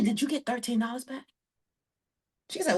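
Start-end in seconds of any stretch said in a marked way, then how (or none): 0.0-1.2 softer, half-swallowed question; asking offhand, almost under her breath; mumbled first ask. Retold or imitated. To the lady at the table, a soft offhand question.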